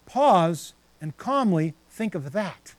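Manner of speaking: slow, deliberate reading; calmly drawn out; slow calm pause. Shown as a demonstration. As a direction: let each word settle. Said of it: "as the Amplified Bible says".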